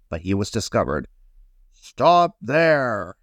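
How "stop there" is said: higher, firm call to halt; crying out. A narrator's reading. Big brush discovers the little paintbrush, crying out. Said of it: "cried one of the big brushes".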